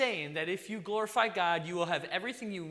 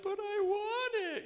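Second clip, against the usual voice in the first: put-on much higher and slower, a whining crying kid; whiny child. A child begging the preacher for candy, whining. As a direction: whine it like a small child about to cry.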